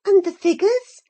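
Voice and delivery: high, loud eager question; cried encouragingly. Acted. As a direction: egg him on, loud and bright. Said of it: "I cried encouragingly".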